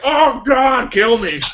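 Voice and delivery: high, laughing mock agony; mock death plea through a laugh; voicing doomed spaceship. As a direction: give the doomed ship a comic plea. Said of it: "it's like".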